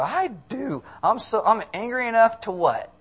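high, loud imitation of a teen girl; mock teenage tantrum voice; junior high girl. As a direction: play an indignant schoolgirl. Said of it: "junior high girl".

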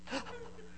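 a quiet, high, breathy gasp; sharp gasp like the movie moved her; imitated emotional gasp. Pastor imitating his wife after The Notebook, quiet gasp. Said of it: "she's like".

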